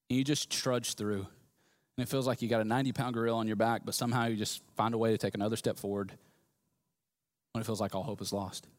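heavy and downcast, low and quiet; weary. Acted. Preacher describing life without hope, low and weary.

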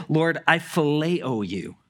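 slow, deliberate "phileo"; slow earnest confession. Retold or imitated. Peter answering Jesus, slow and humble. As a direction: place each word with care. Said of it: "He says".

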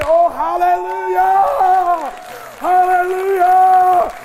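long, high, ringing shout of praise; shouted hallelujah. Real, not acted. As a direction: stretch it out and shout it to the rafters.

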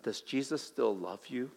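very soft, low voice of the tempter; insinuating whisper. Acted. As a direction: whisper doubt into someone's ear. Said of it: "Satan kind of whispers this in my ear".

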